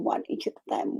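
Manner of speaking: soft echo. Real, not acted. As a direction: let the repeat fade to a hush. Softer echo of the phrase.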